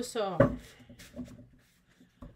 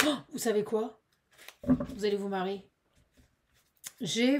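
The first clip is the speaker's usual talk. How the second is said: ordinary delivery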